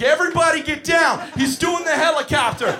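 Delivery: panicked high, loud warning yell; losing it. Retold or imitated. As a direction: shout like everyone must take cover now. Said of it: "And I lose my shit".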